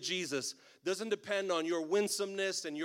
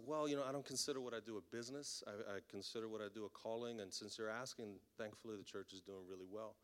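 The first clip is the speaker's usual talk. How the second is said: restrained politeness; 7 semitones lower, 12 dB quieter than usual. Retold or imitated. Restrained reply to officer after God helped.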